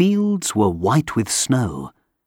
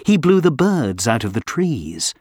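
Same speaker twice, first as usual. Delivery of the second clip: usual speaking voice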